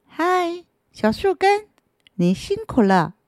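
high, odd little greeting; a strange creature calling out of the dark. Acted. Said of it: "好奇怪的声音".

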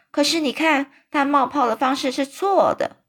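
put-on a young girl calling out in protest; little girl's cry. A narrator's reading. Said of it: "大叫着说".

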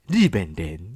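put-on playful Japanese-accented Mandarin; mock Japanese accent. Joking about a Japanese friend, in a mock Japanese accent. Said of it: "又是日本人".

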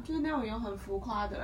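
usual speaking voice

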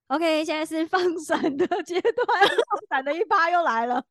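teasing announcement. Real, not acted. Teasing about showing off love.

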